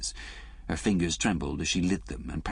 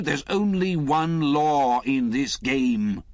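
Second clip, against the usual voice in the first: slow, louder, hard retort; 8 semitones higher, 6 dB louder, much slower than usual. A narrator's reading. Lemus snapping back at Liz, slow and hard. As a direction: bite back slowly. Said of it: "Lemus retorted".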